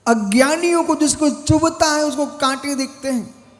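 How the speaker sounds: high, insistent sermon voice; declamatory preaching. Real, not acted. Monk teaching disciples about those who see only thorns, declamatory preaching. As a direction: preach it out to the listeners, pitch held up.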